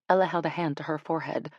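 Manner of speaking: plain reading voice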